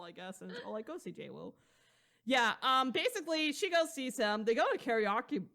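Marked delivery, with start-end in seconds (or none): 0.8-1.5 devil whisper. Retold or imitated. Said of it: "[name]'s being the devil".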